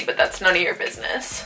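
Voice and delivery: cheeky whispered aside; whispering. Pretend. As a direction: whisper it behind your hand. Sassy aside to the viewers.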